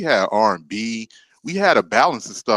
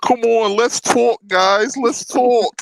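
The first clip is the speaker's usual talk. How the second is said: mock Brooklyn accent; 10 semitones higher, much slower than usual. Imitating friend's Brooklyn voice.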